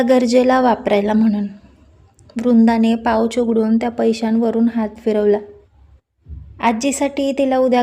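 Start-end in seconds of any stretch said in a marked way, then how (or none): none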